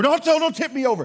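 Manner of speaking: high, loud panicked plea; 1 mock pleading. Preacher voicing a believer begging God, mock panicked plea. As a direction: beg like a scared teapot.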